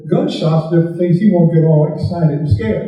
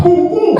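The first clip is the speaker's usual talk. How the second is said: two sharp high boom sounds; mock gunshots; 13 semitones higher, 3 dB louder than usual. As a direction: bark out the shots like a shotgun. Retold gunshots imitated.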